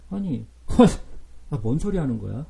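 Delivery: ordinary talk, nothing marked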